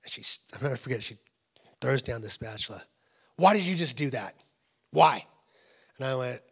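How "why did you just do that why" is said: louder, incredulous questioning; baffled demand. Acted. Wife confronting husband after his kiss, loud and baffled. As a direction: raise the voice, punch out the question.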